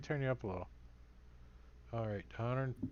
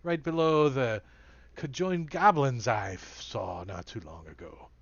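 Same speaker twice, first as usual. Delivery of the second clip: NPC voice; 7 dB louder than usual. Acted. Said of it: "He's like".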